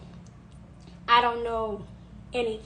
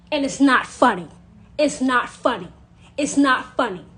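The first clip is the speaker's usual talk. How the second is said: loud, insistent repeat; forceful loud repetition; emphatic; 8 dB louder than usual. Real, not acted. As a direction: hammer it home, louder each time. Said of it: "repeated insistence".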